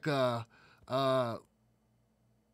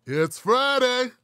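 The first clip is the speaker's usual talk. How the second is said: high, loud, drawn-out FRIDAY; excited announcement; 7 semitones higher, 6 dB louder, slower than usual. Real, not acted. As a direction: stretch out Friday like a hype man. Hyped show opener greeting the audience.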